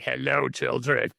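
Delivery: slow, lightly voiced creepy old grandpa; crazy Grandpa voice. Imitating the grandpa greeting everyone in town, slow and mildly creepy. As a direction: drag out a mild creepy greeting. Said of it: "He has really committed to this crazy voice".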